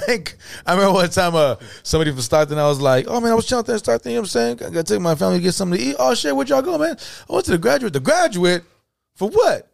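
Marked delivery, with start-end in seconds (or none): 3.1-6.0 high, fast, chatty imitation; fast put-on voice of a local; mimicking a Stockton guy. 6.0-9.7 high, incredulous questions; high mock-surprised friend; mock shock.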